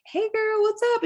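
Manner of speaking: very high singsong hello; friendly phone greeting. Retold or imitated. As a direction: greet a girlfriend brightly, high-pitched. Retold cheerful phone greeting to friend.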